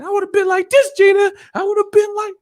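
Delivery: very high falsetto impression; Mama Payne. Mama Payne boxing in front of Gina, high falsetto. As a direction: squeal it in mock fighting mood. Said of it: "he was playing Mama Payne".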